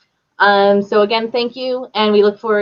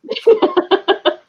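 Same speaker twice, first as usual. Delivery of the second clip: bright laugh jumping high; high burst of laughter; 12 semitones higher than usual. Real, not acted. Host laughing at the guest's story, high laugh.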